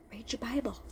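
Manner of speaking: barely voiced, soft and tender as God; gently and kindly. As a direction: hush it out like a kind, patient God. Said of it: "very gently, very softly, very nicely, very kindly said".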